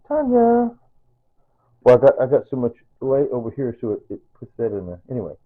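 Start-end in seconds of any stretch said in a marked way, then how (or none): 0.1-0.7 high-pitched, lilting name; high fond call. Real, not acted. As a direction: say the name high and sing-song. Names his late Tanya fondly.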